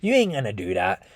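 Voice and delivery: low, quiet offhand dismissal; brotherly brush-off. Retold or imitated. One brother telling another to shut up, mild brotherly brush-off. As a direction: lightly imitate a brother casually shutting him down. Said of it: "like a brother telling to another brother".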